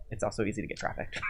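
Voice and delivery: breathy whisper, a little fast; whispered aside. Real, not acted. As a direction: drop the dark punchline as a whisper. Dark joke dropped after pause.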